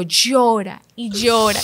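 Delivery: slow, stretched mock sob of llora; a hint of wailing, slowed; drawn-out crying. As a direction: drag out llora like weeping. Describing herself crying.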